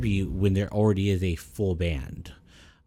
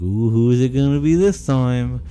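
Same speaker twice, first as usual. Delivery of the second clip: eager, higher and louder; mock eager; 4 semitones higher, 5 dB louder than usual. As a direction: pretend to be hooked on the gossip. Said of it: "And I was like".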